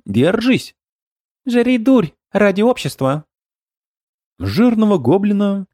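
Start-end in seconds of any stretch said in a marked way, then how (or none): 0.1-3.3 high goblin voice. A narrator's reading. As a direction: squeal it like an excited goblin. Goblins force-feeding comrade.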